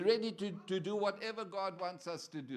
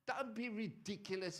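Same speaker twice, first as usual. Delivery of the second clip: ordinary delivery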